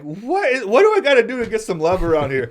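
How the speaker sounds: high, very loud comic outburst; mock exasperation. Class clown protests to the world about his trauma, mock exasperation. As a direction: wail it for laughs, breaking into laughter.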